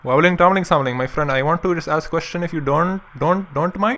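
put-on friendly Indian accent, retroflex t and d. Prank-calling the drive-thru worker in a mock Indian accent.

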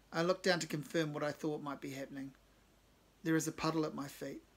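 plain reading voice

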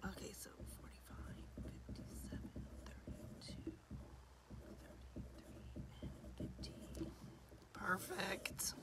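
whispered numbers; a hoarse whisper with her voice nearly gone. Real, not acted. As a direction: read them off in a whisper.

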